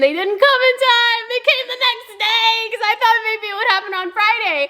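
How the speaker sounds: high-pitched exasperated whine; annoyed. Real, not acted. Telling how the engagement dresses came late, annoyed. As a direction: complain high up in your voice, fed up. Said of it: "I'm also so annoyed".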